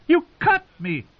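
very high, shocked yelp; crying out. A narrator's reading. Wounded singer to the knifeman, high shocked cry. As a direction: yelp it as if just cut. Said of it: "he cried out".

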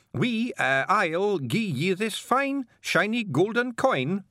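high, drawn-out Scots voice; odd bearded Scotsman putting on politeness; blustering Scottish stranger. Acted. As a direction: make a slow, hesitant offer in high-pitched broad Scots. Bearded stranger hails carter.

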